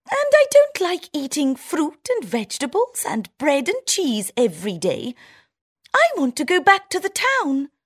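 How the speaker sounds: very high, squeaky little mouse voice; a chirpy town mouse showing off. Acted. Town mouse chatting to her country friend, high squeaky voice.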